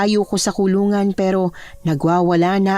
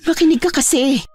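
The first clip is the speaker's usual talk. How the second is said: raised, higher-pitched demand; exasperated shouting; 5 semitones higher than usual. Acted. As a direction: snap at him to listen. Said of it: "Huwag mo ako sigawan!".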